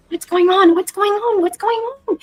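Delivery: high squeaky creature voice; squeaky little critter; small creature voice. Acted. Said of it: "from Ghibli".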